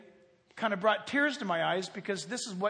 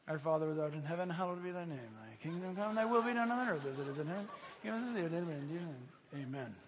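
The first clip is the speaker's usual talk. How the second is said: fast, soft, mechanical mumbling of the Lord's Prayer; rattled-off rote prayer; 3 semitones lower, 7 dB quieter, faster than usual. Pretend. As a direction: rush through it like a bored habit. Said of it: "Can you imagine going to God?".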